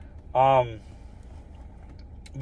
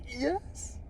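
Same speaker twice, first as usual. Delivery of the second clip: choked-up yes; 11 semitones higher, 13 dB quieter than usual. Retold or imitated. Overwhelmed yes before bawling.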